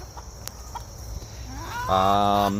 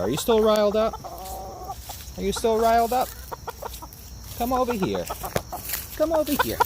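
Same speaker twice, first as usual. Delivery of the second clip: very high sing-song call to the rooster; high pet-calling voice; calling a chicken; 3 dB quieter, faster than usual. Real, not acted. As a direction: coax him over in a high lilting voice.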